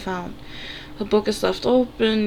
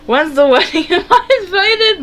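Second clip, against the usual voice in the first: much higher, louder, laughing through it; big brother teasing the couple with laughter; laughing tease; 9 semitones higher, 7 dB louder than usual. Acted. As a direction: tease them through giggles.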